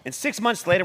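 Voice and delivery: ordinary delivery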